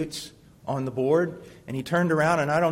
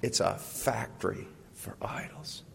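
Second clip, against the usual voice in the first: breathy hushed whisper, lower; 7 semitones lower, 12 dB quieter than usual. Acted. Preacher whispers to the congregation about the heart. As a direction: lean in and whisper it like a secret.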